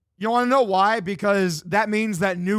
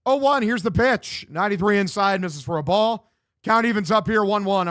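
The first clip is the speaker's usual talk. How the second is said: higher, projected baseball call; live play-by-play; 4 semitones higher, 4 dB louder than usual. Real, not acted. Streamer calling the game for viewers, play-by-play voice. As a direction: call the pitch like a radio announcer.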